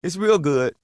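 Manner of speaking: a hint of a friendly older shopper; older lady at the store. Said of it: "and she says".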